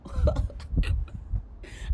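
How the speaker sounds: loud, demonstrative burst of laughter; acted laugh. Quoting someone laughing at a billionaire, acted laugh. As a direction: laugh pointedly at the rich. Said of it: "I'm laughing at Elon Musk right now".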